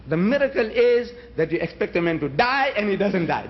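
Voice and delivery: ordinary talk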